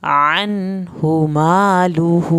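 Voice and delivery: slow, drawn-out tajweed chant; Quranic recitation. Shown as a demonstration. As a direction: recite each word slowly, melodically. Recites verse.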